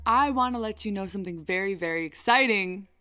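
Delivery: ordinary talk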